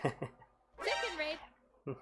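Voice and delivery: high, slow drawn out chicken raid; imitating Kiara. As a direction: mimic the idol's catchphrase.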